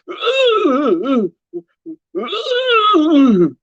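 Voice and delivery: high hammed-up moans; a teasing, high mock moan. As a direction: imitate the lady moaning on the hot mic, overdone. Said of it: "she kept going".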